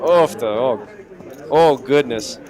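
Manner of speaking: mock Minnesotan dismay; loud rounded Minnesota O's; Minnesota accent. Commentator parodying home-state exclamations, Minnesota accent. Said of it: "that's Minnesota".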